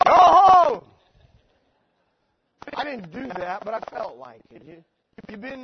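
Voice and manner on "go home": sudden high, booming yell; imagined outburst. Pretend. Preacher imagining sending his visitor away, sudden loud shout. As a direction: yell it like venting a fantasy.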